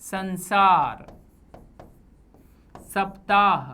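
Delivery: संसार slowly, s crisp behind the teeth; संसार, slow dental s; dental s demo. Shown as a demonstration. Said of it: "जीभ ऊपर के दांतों को पीछे से".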